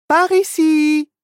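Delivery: high, slow, confident leader; little witch guiding. Acted. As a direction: lead the group onward. (petite sorcière qui guide)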